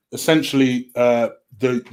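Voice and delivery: ordinary talk, nothing marked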